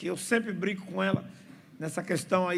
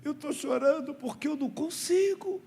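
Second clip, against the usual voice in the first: imitating a whiny little boy, pitched much higher; mock-tearful kid's plea; crying child; 8 semitones higher than usual. Boy explains to the pastor why he is crying, in a high mock-whiny voice. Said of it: "por que você está chorando?".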